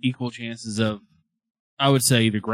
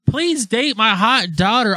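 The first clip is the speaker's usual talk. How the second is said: high, slow pleading mother voice; mocking mother; 9 semitones higher, slower than usual.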